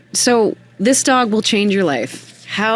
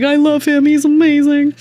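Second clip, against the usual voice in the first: high, louder gushing; 10 semitones higher, 4 dB louder than usual. Retold or imitated. Falling for the rescued dog, high gushing. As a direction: gush about the dog. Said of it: "was like".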